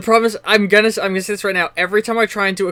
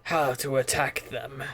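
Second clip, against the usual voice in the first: persuasive game character; 3 semitones lower, 4 dB quieter than usual. Acted. Game master plays non-player character.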